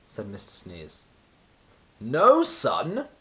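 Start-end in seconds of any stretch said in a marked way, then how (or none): 2.0-3.1 high, loud astonished question; exclaimed. A narrator's reading. Wizard hearing Coldland has no sun, astonished. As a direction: gasp it out in disbelief. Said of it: "exclaimed the wizard".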